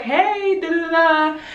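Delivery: high, drawn-out sing-song hey; retold greeting. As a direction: lilt it high like a casual hello. Retold friendly greeting reply.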